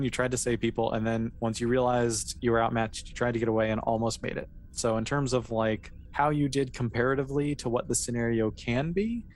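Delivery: ordinary talk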